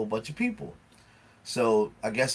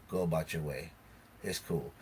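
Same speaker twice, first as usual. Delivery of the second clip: lower, much softer tone; dismissive sign-off; 6 semitones lower, 12 dB quieter than usual. Retold or imitated. Retelling how he ended the quarrel, dismissive. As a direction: let it drop quietly.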